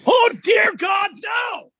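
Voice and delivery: dramatic howl of dismay; anguished shout. Real, not acted. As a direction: cry out in mock despair. Hearing the Kardashians return.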